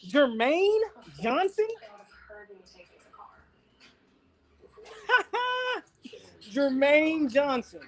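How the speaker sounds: high, slow, stretched name with a laugh; excited drawn-out name. Real, not acted. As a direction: savor the name with delight.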